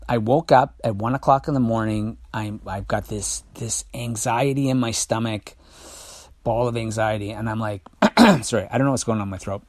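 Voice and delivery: ordinary talk, nothing marked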